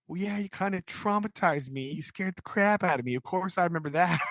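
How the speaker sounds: mock indignation. Pip's indignant reply, acted.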